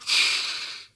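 long unvoiced shush; hushing shh. Doctor stopping himself spoiling the future, shushing. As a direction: cut yourself off with a shh.

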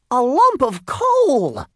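put-on very high, loud, drawn-out boy's cry; thrilled boy at full pitch; excited cry. A narrator's reading. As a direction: cry out in delight, slowly and loudly. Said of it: "Jasper cried".

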